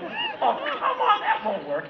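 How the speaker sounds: a giddy, high-pitched laugh of disbelief. Retold or imitated. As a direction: scoff through laughter at the idea. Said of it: "I was like".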